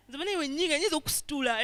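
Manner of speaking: lightly voiced macho bragging; boasting angry guy. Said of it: "I've seen guys who be".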